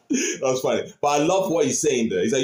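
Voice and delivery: ordinary talk, nothing marked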